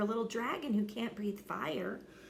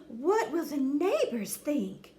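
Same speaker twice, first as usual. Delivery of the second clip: higher, fretting mother; anxious mother dragon; worried; 6 semitones higher than usual. Acted. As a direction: wring your hands over it. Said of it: "worried his mother".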